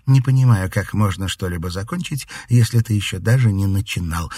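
plain reading voice

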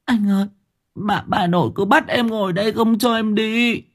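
put-on high, tearful child's voice; sobbing little girl. A narrator's reading. Little girl complaining to her brother, tearful. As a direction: whimper it like a frightened little sister. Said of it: "Con bé Út nức nở".

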